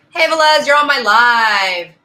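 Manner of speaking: loud call across the room. Real, not acted. Calling out to someone off-mic.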